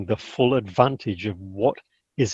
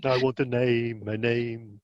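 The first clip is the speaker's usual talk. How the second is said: ghost voice; 2 semitones lower, 5 dB quieter, slower than usual. Retold or imitated. Voicing the ghost.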